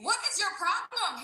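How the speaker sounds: high, drawn-out exasperated demand; acted-up indignant complaint. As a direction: stretch it out slowly in high-pitched disbelief. Teller's exasperated aside at the man.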